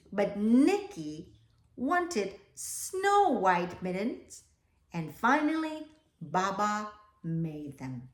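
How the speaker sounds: put-on slow, even read-aloud to children; storybook reading. A narrator's reading. Teacher reading the class a picture book, slow storytelling.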